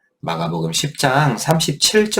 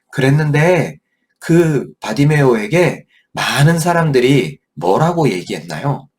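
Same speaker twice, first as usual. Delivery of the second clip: ordinary talk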